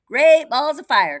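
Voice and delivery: high, loud cry; cried out. Acted. Goose crying out before leaving, high and loud. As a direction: goose hollers. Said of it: "cried the goose".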